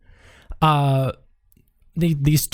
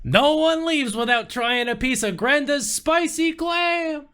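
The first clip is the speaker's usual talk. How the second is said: much higher, slow sing-song; ominous high tease; 13 semitones higher, 3 dB quieter than usual. Acted. Waitress warns Cosmo nobody leaves without trying the clam, slow and high. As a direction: linger on it like a threat.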